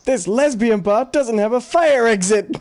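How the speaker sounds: high, slow cartoon outburst; Homer Simpson. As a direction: exclaim it as a mock-indignant cartoon discovery. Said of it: "he just stands up and he goes".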